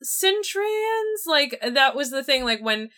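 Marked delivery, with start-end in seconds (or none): 0.0-1.3 high, slow, put-on voice asking; mock disbelief, voice pitched way up; incredulous quote. Herself questioning Ymir, high incredulous put-on voice. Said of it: "and I'm like".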